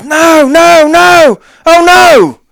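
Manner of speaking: very high, loud panicked shouts; terrified yelling. Retold or imitated. Acting out terrified Brody jumping up, panicked high yelling. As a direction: yell it like a terrified hunter fleeing. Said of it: "He's terrified".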